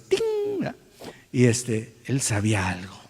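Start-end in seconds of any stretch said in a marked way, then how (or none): 0.0-0.6 high cartoon twinkle sound; ping it like a gleaming tooth in a cartoon; cartoon tooth sparkle. Preacher imitating the cartoon gleam of a smile, high ting. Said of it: "brilla así como en las caricaturas".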